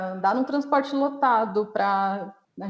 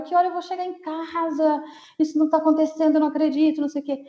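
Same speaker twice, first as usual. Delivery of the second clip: high, mildly raised mock yell; retold yelling; 7 semitones higher, faster than usual. Retelling a stranded woman's outburst at the bus stop, mock yelling. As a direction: imitate a distressed woman yelling, pitched well up. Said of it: "ela gritava".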